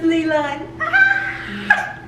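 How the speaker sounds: excited shout. Real, not acted. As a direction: yell in delighted shock. Excited at character reveal.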